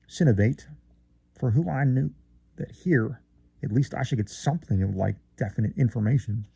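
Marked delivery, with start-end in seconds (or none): none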